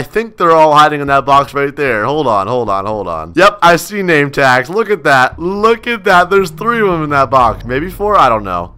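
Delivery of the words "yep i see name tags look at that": ordinary talk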